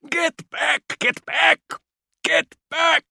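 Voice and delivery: high-pitched, desperate repeated shouting; hoarse frustrated yell. A narrator's reading. Kord yelling over and over at the crowd, hoarse and desperate. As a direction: yell it over and over, full of hate. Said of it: "his voice hoarse with frustration and desperate hate".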